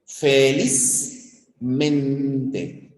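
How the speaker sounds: "Felizmente" very slow, with both "liz" and "men" stressed; slow syllable-by-syllable "Felizmente"; two-stress demo word. Shown as a demonstration. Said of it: "tienen dos acentos".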